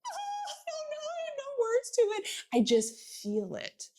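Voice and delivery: high, teary, words breaking; nearly crying over the kids; choked-up parent. Retold or imitated. Parent asked why they love their kids, teary and choked up. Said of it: "You're like".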